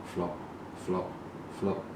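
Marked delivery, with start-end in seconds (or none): none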